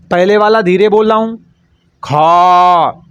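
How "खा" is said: long, breath-heavy खा; forceful aspiration. Shown as a demonstration. Teacher contrasting the second letter with the first, खा said forcefully. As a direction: push खा out hard and hold it. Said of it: "दूसरे वाले में ज़्यादा ताकत लगा रहा हूँ".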